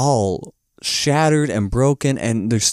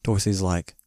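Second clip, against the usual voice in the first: ordinary talk, nothing marked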